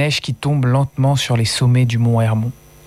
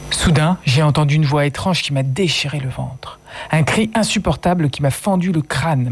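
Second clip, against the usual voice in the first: usual speaking voice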